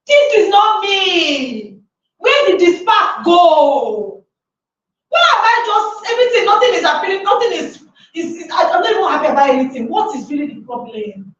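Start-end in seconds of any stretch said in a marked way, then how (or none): 0.1-7.2 despairing cry. Pretend. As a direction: cry out at the lost spark. Voicing inner despair.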